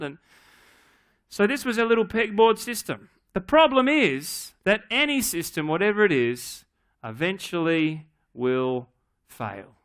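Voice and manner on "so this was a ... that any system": normal talking voice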